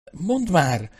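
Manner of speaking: high, urgent pleading; impatient. A narrator's reading. Royan pestering Nicholas at the hole, impatient. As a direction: press for an answer, pitched well up. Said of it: "táncolt mellette Royan a türelmetlenségtől".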